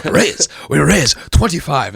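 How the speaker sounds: mock agitated poker player; half-hearted yelly impression; yelly poker pro. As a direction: imitate a worked-up pro, only mildly yelly. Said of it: "Professional was very yelly".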